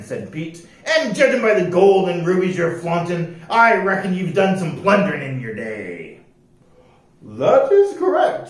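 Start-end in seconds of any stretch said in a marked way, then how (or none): 0.8-6.2 loud pirate captain, sizing up a recruit. Acted. Voices pirate captain Pete. 7.2-8.5 high, slow, precise voice; un-piratelike rascal. A narrator's reading. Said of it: "said the rascal".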